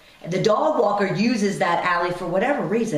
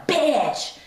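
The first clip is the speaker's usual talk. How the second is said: mock yell, pitched higher; retold loud insult; 7 semitones higher than usual. Dog walker screams at the speaker over the leash, higher mock yell. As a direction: fling the insult high, imitating her. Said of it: "just really loud".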